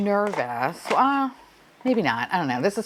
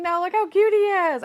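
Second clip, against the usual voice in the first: cooing; 12 semitones higher than usual. Real, not acted. Cooing at the figure.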